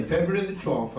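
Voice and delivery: normal talking voice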